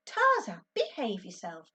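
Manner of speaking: sharp, loud stern call; mother reprimanding the dog. A narrator's reading. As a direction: scold the dog firmly. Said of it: "Mum said sternly".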